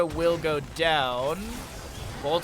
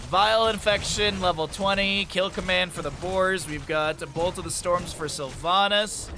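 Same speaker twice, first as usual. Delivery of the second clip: normal talking voice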